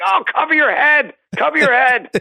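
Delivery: high-pitched mock scream of warning; imitated screaming. Mocking a dad heckling a young shooter, mock screaming. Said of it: "Screaming at him".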